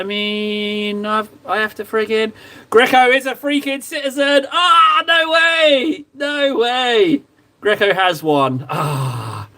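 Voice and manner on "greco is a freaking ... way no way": real shout of astonishment; excited disbelief. Real, not acted. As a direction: cry out in amazement. Excited outburst at Greco's news.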